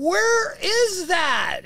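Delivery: high, loud, long-drawn cry; hammed-up craving; demanding wail. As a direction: wail it out slowly as an outraged demand. Voices the body demanding its wine.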